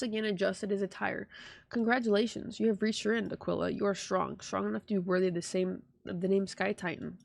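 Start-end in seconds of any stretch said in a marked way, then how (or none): none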